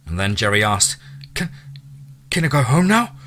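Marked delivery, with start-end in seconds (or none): 1.3-3.2 higher, timid pleading stammer; broken, fearful. Acted. Prisoner begging his captor to go home, broken fearful plea. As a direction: ask it with broken spirit. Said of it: "with a voice of broken spirit and unresolved fear".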